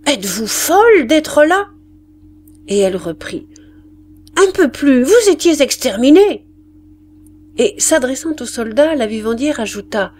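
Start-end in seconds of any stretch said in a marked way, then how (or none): 0.1-1.7 high-pitched angry scolding; furious. A narrator's reading. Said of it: "La vivandière ajouta furieuse". 4.4-6.5 high, loud rebuke; furious scolding. A narrator's reading. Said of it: "ajouta furieuse".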